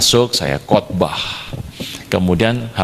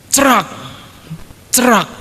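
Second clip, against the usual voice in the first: short, high chop sounds, one at a time; imitated chopping noise; 11 semitones higher than usual. Preacher mimics hacking the tree, sharp high sound effect. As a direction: mimic a blade striking wood.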